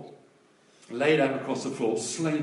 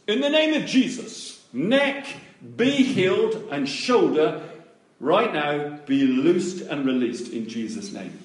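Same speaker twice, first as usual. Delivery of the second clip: commanding prayer; 6 dB louder than usual. Retold or imitated. Minister praying over a girl's neck, loud commanding prayer. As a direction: command it firmly, word by word. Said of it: "I said that on the outside".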